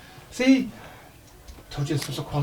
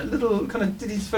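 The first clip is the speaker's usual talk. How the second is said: usual speaking voice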